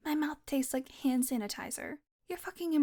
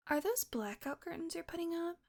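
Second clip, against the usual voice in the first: ordinary talk, nothing marked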